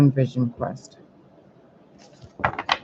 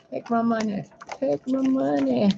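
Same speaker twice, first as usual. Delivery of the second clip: much higher, silly mocking voice; mocking gullible fans; 11 semitones higher than usual. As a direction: squeal it like a dazzled fan. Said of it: "you guys are like".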